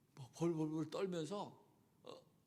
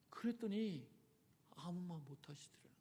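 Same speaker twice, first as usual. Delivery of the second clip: ordinary delivery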